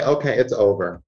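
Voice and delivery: low, matter-of-fact acceptance; resolved inner voice. Acted. Inner voice accepting an ending, low and settled. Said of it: "Our inner voice has to say".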